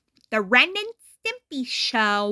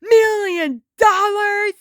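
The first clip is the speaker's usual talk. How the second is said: shocked Ren voice, much higher and louder; hollered; 8 semitones higher, 5 dB louder than usual. A narrator's reading. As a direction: yell it out in disbelief. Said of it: "he hollered".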